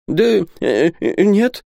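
high, hesitant stammer. A narrator's reading. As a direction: stumble over the words in confusion. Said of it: "заикался Исаак".

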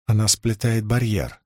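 ordinary delivery

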